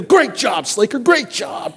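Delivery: a coach praising a player; cheering coach. Retold or imitated. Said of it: "They go".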